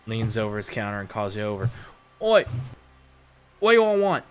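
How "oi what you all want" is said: higher, louder Australian bartender calling out; bartender accent. Acted. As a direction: hail them across the bar in a rough Australian accent. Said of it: "His accent clearly isn't Californian".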